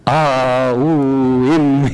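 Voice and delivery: "ah", "ooh", "im" as three chopped-apart sounds; separate syllables. Shown as a demonstration. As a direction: break "ah, ooh, im" into three separate pieces, the wrong way. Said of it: "That's not how you do it".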